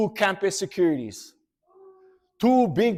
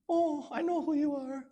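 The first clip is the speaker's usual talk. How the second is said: put-on girl voice; 11 semitones higher, 8 dB quieter than usual. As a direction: tease him sweetly like the girl. Girl answers Kyle.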